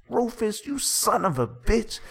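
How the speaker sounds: high, loud, outraged screech; acted scream. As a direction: scream it like a betrayed wife in a melodrama. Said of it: "Then she screams".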